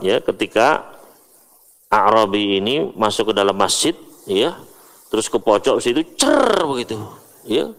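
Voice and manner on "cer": short imitated trickle sound; peeing sound. Teacher mimics the Bedouin peeing in the corner, a quick playful trickle sound. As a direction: mimic the splash of pee. Imitating man urinating in mosque.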